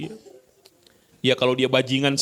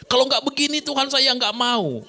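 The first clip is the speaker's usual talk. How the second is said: stubborn demand; 10 semitones higher than usual. Retold or imitated. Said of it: "people demanding of God".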